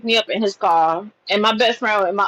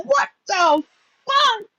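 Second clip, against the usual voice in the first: fuck jumps high in shock; high, startled what the fuck; stunned exclamation; 10 semitones higher, slower than usual. Retold or imitated. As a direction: blurt it out, caught off guard. Reacting to sudden kiss.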